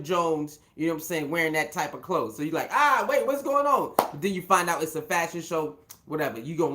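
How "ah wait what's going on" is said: much higher, louder mock alarm; acted startled reaction. Viewer first seeing the odd outfit, mock startled. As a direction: blurt it out in put-on surprise.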